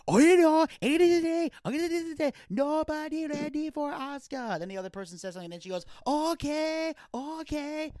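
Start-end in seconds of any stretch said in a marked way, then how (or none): 0.1-4.6 high, loud imitation of Asuka's accented promo; Asuka promo impression. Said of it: "That's every Asuka promo". 6.1-7.9 high, imitating Asuka saying okay; drawn-out mock Asuka okay; Asuka promo impression. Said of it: "That's every Asuka promo".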